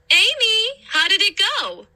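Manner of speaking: a mother calling from the kitchen; mom calling out. A narrator's reading. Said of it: "Mom called from the kitchen".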